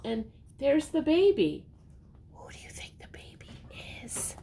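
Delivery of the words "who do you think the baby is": fast hushed aside; whisper aside. Real, not acted. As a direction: whisper it as a secret to the little one. Hushed aside to the listening child.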